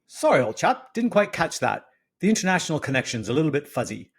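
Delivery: put-on a breezy British old chap on the phone; mock upper-class British. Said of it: "accent that I had been assured was very upper class Brit".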